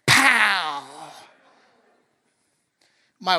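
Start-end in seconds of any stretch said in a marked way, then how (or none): 0.1-0.8 loud punchy sound effect; mock pow. Pastor acting his reflex swat on the congregation's behalf, loud pow. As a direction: smack the word like a slap.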